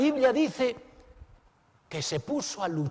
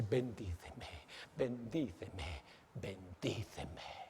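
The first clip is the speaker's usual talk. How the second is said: much softer, slow, fading "Bendíceme"; hushed plea; 10 semitones lower, 14 dB quieter, slower than usual. Acted. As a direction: let the plea sink almost to nothing. Jacob repite su súplica. (súplica muy baja que se apaga)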